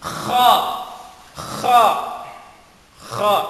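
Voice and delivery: Kha scraped rough in the back of the throat; guttural kha, Arabic letter. Shown as a demonstration. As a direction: rasp the Kha like clearing your throat. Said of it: "parang mikhrad".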